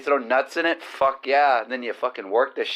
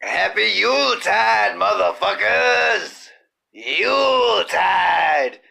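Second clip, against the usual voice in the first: drawn-out yell; 5 semitones higher, slower than usual. Acted. Joyful holiday yell.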